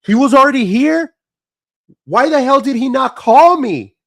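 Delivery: high, loud shocked cry of disbelief; reliving the panic of a missed call, voice shooting up; stunned son finding out his dad came and went. Retold or imitated. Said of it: "I was like".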